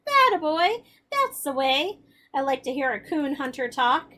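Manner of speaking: bursting out high and loud, then settling; pent-up voice. Acted. Grandpa praising Billy for agreeing to the hunt, pent-up excitement. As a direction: let the held-in joy burst out. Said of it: "in a pent-up voice he said".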